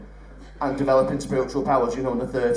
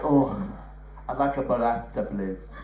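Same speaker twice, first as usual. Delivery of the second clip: mock Italian accent; 5 semitones lower, 5 dB quieter than usual. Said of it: "who does the accent of the food".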